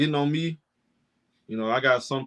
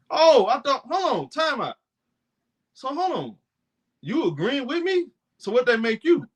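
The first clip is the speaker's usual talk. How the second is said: much higher, hammed-up disbelief; mock surprise; 9 semitones higher than usual. Host mocking critics who suddenly agree, high and stunned. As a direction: hold on, act stunned and amused.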